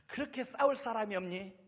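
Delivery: mild sneering taunt; Goliath mocking the army through David; mocking giant. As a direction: toss off the jeer with scorn. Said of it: "껄껄 웃어요".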